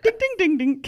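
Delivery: high, quick dings; playful high ding sound effect; imitated dinging.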